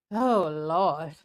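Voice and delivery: usual speaking voice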